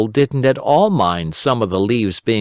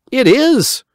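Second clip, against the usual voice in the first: put-on high, louder boyish cry; Simple Simon; 6 dB louder than usual. A narrator's reading. Simon answering Uncle Wiggily, high and loud. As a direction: blurt it like a hungry boy.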